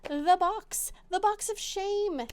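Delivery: high, loud mock-ominous title; mock dramatic announcer. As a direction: announce it like a game-show doom reveal.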